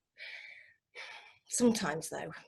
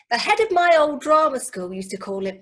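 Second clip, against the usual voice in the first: ordinary talk, nothing marked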